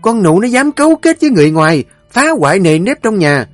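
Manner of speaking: loud, high, fast tirade of commands; mother-in-law raging at the servants, shouting orders; furious matriarch. Acted. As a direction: bark orders to have the maid beaten.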